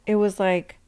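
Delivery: ordinary talk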